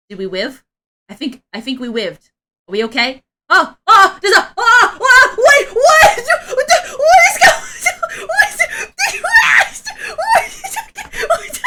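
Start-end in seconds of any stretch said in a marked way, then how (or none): 3.5-11.6 much higher, much louder panicked shrieking; shocked shriek. Real, not acted. Streamer watching the avatar glitch apart, shrieking in real shock. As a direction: burst into disbelieving yells, half laughing.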